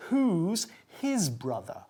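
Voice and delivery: ordinary talk, nothing marked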